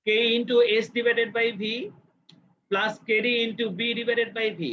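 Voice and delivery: formula read out slowly; slow dictation. Shown as a demonstration. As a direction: dictate each term slowly. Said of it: "অর্থাৎ কেও সমান সমান".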